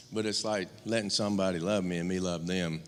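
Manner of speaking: ordinary talk, nothing marked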